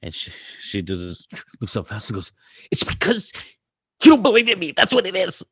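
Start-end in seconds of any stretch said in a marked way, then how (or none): 2.7-5.4 high-pitched, loud mock tantrum; mock angry kid. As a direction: imitate a furious kid's squealed protest. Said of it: "She's mad again".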